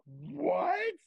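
loud, high shocked outburst; freaked-out shock. Retold or imitated. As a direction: imitate a whole table freaking out. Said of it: "everyone freaks out".

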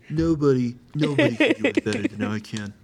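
hammed-up Trump boast; Trump impression. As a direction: brag it like Trump at a rally. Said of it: "And then you gotta get the voice".

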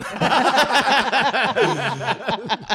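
loud, high laughing fit; burst of laughter. Real, not acted. Cracking up at the gun line.

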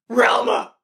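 loud high Scooby yell; Scooby-Doo voice. Retold or imitated. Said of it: "You're like".

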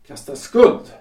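loud, rising "Kastar skuld" with "skuld" hit hard; an offended husband protesting out loud; indignant outburst. Acted. Husband repeats her accusing words.

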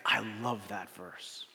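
soft, slow, hushed awe; quiet awe. Retold or imitated. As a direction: breathe it like a treasured secret. Friend's quiet heartfelt quote.

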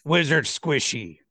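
dim brute voice. Acted. Guard mocks the wizard.

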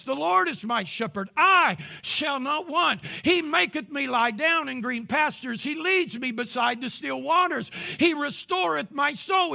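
climbing, thundering psalm recitation; preaching at full force. Acted. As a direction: preach the psalm with rising fire.

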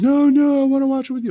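high, loud pleading wail, imitating the son; retold crying protest. Son begging dad to keep watching, imitated crying wail. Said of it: "now he's sobbing and crying".

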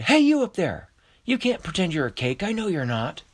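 high shout, easing into accusation; calling up. A narrator's reading. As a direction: holler up, then confront them. Astro calls up into tree.

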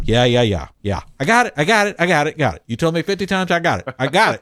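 hammed-up exasperated teen; impatient brush-off. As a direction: wave the parent off, fed up. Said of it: "you're going".

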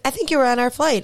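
high, lightly voiced girl; girl from the flight recognizing her in the hot tub, high and chirpy; mimicking the young girl. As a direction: chirp it up like a friendly young stranger. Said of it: "She's like".

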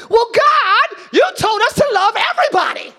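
high, loud, half-crying mock plea to God; whiny complaint to God.

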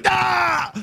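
loud high shout of protest; sudden loud cry; joyful shout. Shown as a demonstration. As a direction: let out a big loud "Ah!". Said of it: "Now I can go".

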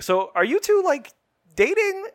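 high-pitched, loud teasing question; high, loud quoted voice. Retold or imitated. Acted quote of Rachel prying.

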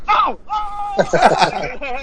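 high-pitched comic scream; Sam Kinison. Retold or imitated. Imagining a ringtone going off in a store, screaming. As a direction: let out two wild screams. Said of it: "uh, Sam Kinison".

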